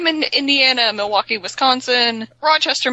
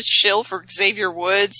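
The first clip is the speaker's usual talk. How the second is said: ordinary talk, nothing marked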